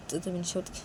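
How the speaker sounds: ordinary delivery, nothing marked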